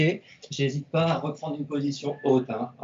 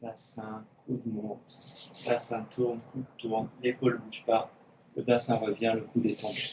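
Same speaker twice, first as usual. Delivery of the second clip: quiet coaching cues; 4 semitones lower, 7 dB quieter than usual. Shown as a demonstration. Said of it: "cues while practising with class".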